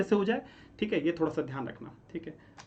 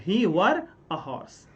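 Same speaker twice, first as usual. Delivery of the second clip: slow dictation; much slower than usual. Shown as a demonstration. Teacher gives corrected phrase.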